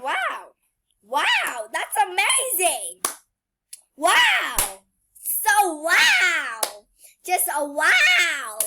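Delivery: loud amazed wows; wowing. Real, not acted. Reacting to the game, loud amazed wow. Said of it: "I should stop wowing".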